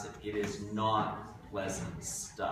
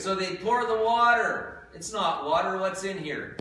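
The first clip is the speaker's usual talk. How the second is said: showman build-up; 7 semitones higher, 7 dB louder than usual. Real, not acted. Showman magician demo.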